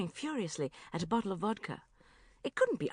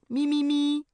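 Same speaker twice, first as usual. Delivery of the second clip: high, loud eager me me me; eager Tom; 10 semitones higher, 10 dB louder than usual. A narrator's reading. Tom grabbing the definition, high and loud. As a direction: jump in wanting it for himself.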